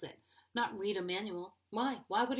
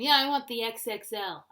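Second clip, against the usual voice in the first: a hint of a confident guy, louder; lightly voiced car-buying guy; 3 semitones higher, 9 dB louder than usual. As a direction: order loudly like a guy at a dealership. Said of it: "Guy will walk in".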